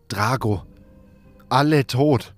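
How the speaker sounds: usual speaking voice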